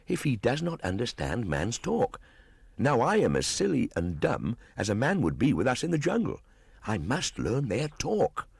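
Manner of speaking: put-on a wild boy puzzling aloud; young Mowgli. Mowgli musing to himself.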